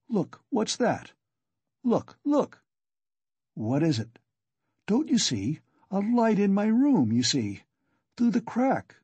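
high, startled and urgent; alarmed. A narrator's reading. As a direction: point it out in sudden alarm. Feverish man sees a light.

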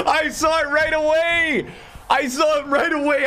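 over-the-moon gamer yell; frat bro. Real, not acted. Pulls Zidane from icon pack.

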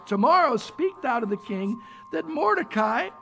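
reading the verses aloud, measured; scripture reading. Acted. Preacher reads the verses of Esther aloud, measured. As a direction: read the scripture to the congregation.

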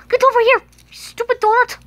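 high, loud grabby yell; furious high-pitched toy shout; angry shout. Acted. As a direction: bark at the donut to come. Duncan grabs the donut.

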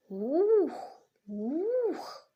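loud, high hooting "Ух, ух"; owl hooting cry. Acted. Owl shouting "Ух, ух" into the dark, loud high hoots. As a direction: hoot out like a big owl scaring the night. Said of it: "І як почала кричати".

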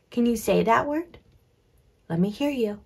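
ordinary delivery

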